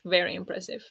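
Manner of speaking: a quiet afterthought compliment; soft echo. Real, not acted. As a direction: let it fall away, almost to yourself. Repeating the praise softly.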